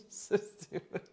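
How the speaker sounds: words lost in giggles; voiceless giggly aside; breathless laughing. Real, not acted. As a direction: laugh so hard the voice drops out. Giggling at own silly joke.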